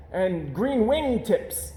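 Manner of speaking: cub voice. Acted. Cub adds to lie.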